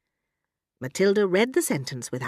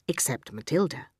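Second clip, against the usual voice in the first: plain reading voice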